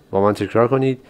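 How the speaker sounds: ordinary talk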